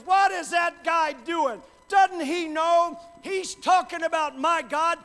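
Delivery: loud, high, slow outraged cry; indignant boy's shout. Retold or imitated. Young David outraged at the giant, shouting slowly and loudly. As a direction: shout it like a boy who can't believe the insult. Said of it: "walks up and says".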